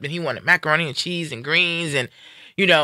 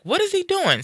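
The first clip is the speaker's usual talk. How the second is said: much higher, startled disbelief; alarmed question. Retold or imitated. Daughter sees her father slumped, eyes closed, alarmed. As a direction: ask it as alarm starts to rise.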